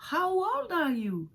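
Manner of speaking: exaggerated English question; teacher question. Shown as a demonstration. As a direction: ask it slowly and brightly. Teacher asks model question.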